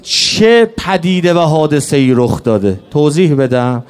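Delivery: keen the martyrdom tale, building to a cry; mourning lament. Acted. Rowzeh lament, rising to wail.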